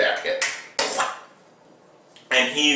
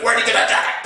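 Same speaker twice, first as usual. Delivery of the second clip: high, urgent demand; mock accusing question; 9 semitones higher, much faster than usual. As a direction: fire the question in a raised voice. Acts accusing question mid fight.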